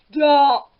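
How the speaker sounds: loud, sharp shout; ogre shouts. A narrator's reading. Sleepy ogre silences the harp, loud shout. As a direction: cut the music off with one yell. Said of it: "Then he shouted".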